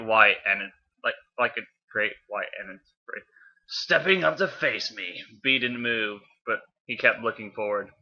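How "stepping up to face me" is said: slow, slightly raised villain voice; drawn-out challenge from a villain; slow villain line. Acted. Villain circling Bee, slow put-on voice. Voicing Megatron's line in story.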